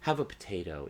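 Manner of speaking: soft, courteous dinner-table offer; politely and quietly, as a genteel host. Retold or imitated. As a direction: imitate a mild-mannered host gently offering food. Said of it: "very politely".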